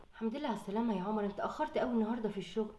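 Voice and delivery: a tired wife greeting her husband gently; soft-spoken mother. Acted. Mother telling the father the girls studied for the tablet, softly.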